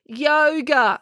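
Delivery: high-pitched sarcastic retort. A narrator's reading. April answering the coach's demand, sarcastic. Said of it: "said April sarcastically".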